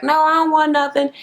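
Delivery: mock indifference. Mocking her fake indifference.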